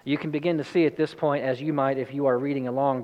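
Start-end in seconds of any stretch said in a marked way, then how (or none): none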